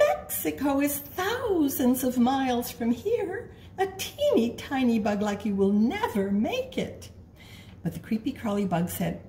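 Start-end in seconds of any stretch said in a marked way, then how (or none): 0.1-7.1 bird voice. Acted. Bird warns the bug.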